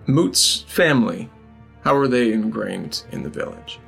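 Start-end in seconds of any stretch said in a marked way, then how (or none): none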